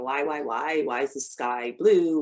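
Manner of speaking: ordinary talk, nothing marked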